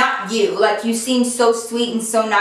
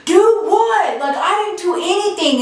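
mocking boyfriend; 7 semitones higher than usual. Mocking her boyfriend's denial in a high whiny voice. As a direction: mimic him as a whiny innocent. Mimicking boyfriend's defensive reply.

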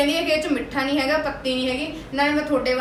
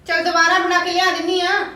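higher and louder, fed up; irritated shout; 3 semitones higher, 4 dB louder than usual. Acted. Daughter-in-law offering to remake the tea, shouting angrily. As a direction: throw the offer out in anger.

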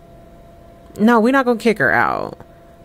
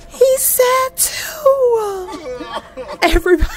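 higher, slow laughing through the words; tearful laughter over a sad scene; slower than usual. Real, not acted. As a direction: giggle it out in disbelief. Laughing at sad game characters.

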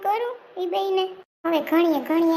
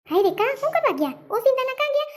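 higher, louder, quicker alarmed questions; surprised dismay; 7 semitones higher, 4 dB louder, faster than usual. Acted. Neighbor startled to learn the father left for the city, alarmed. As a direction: cry out in startled worry.

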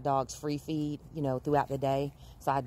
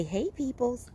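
voicing the dog; 9 semitones higher, slower than usual.

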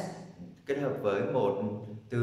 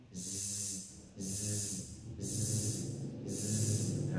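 a long, soft, voiced zzz buzz; soft held zzz buzz to feel the throat vibrate; 8 dB quieter, much slower than usual. Shown as a demonstration. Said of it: "đã có được âm G".

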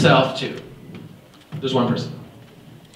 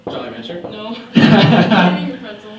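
laughing; 4 semitones higher than usual. Real, not acted. Volunteer's pretzel amuses him.

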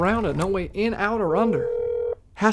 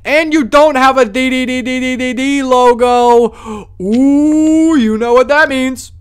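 high and much louder, gleeful; loud excited taunt; 7 semitones higher, 10 dB louder, slower than usual. Acted. Guard gleefully denying entry to an unlisted entrant, loud and high. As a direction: gloat loudly over the rejection.

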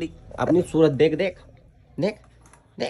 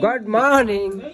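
higher, slow sing-song good morning; cutesy greeting; 7 semitones higher, 4 dB louder, slower than usual. Real, not acted. Greeting the puppy in the morning, slow high coo. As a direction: coo a morning hello to the pet.

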